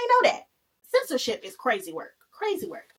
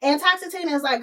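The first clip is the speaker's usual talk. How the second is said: normal talking voice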